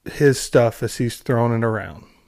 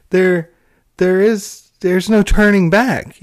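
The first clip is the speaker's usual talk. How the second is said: louder, higher exasperated outburst; 5 semitones higher, 4 dB louder, slower than usual. Real, not acted. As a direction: vent at the writers, pitched up and loud. Exasperated rant at the writers.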